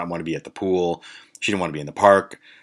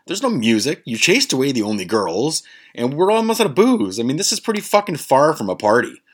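incredulous protest; 7 semitones higher, 3 dB louder than usual. Retold or imitated. Narrator objecting to friend's idea of a party, incredulous. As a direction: pitch climbing in retold exasperation.

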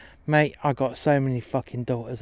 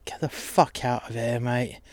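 menacing whisper; 6 dB quieter than usual. Acted. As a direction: lean in and whisper it like a threat. Threatening whisper to cripple.

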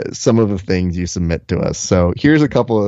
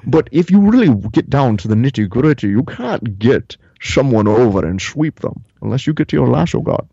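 Connery-style brogue; 3 semitones higher than usual. Acted. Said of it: "the Sean Connery impression that I do".

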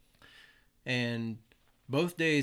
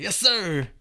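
high, very loud acknowledgement; recruit's shouted reply; 8 semitones higher, 11 dB louder than usual. Retold or imitated. Startled recruit answering his drill instructor, imitated loud shout.

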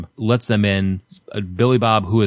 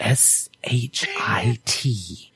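imitated whisper; 2 semitones higher, 4 dB quieter, much slower than usual. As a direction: spell it out in a slow whisper. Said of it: "the way he whispers".

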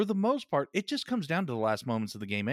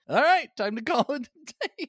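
high, louder sing-out; breezy mock wrap-up pitched way up; mock-casual quote; 11 semitones higher, 4 dB louder than usual. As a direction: call it off in a high, silly voice. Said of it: "they're just like".

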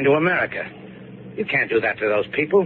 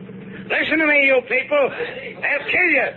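shouting a warning to the refugees below; desperate call. Acted. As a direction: call out a warning across the hold.

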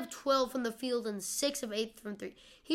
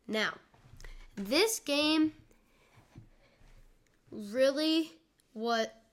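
usual speaking voice

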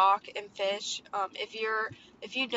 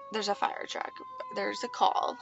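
usual speaking voice

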